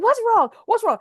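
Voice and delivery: high, urgent call from the bathroom; worried call to her panicking husband; mock alarmed call. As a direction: call out sharply, a touch of alarm. Calling out from the tub.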